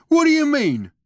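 loud, high, puzzled bellow; booming. A narrator's reading. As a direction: boom out your incomprehension. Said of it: "he boomed".

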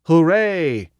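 high, loud whoop of joy; joyful yip. A narrator's reading. Narrator cheers at the odds, a loud high yip. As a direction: cheer like you just won. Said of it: "I jump up and yip".

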